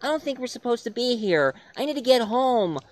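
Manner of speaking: put-on high frightened girl voice; put-on scared teen girl begging; terrified girlfriend. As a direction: plead with him to leave. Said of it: "I'm just so terrified".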